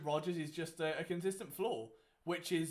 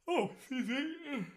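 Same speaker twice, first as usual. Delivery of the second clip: yawning; 7 semitones higher, slower than usual. Real, not acted. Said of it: "It's the first yawn of the evening".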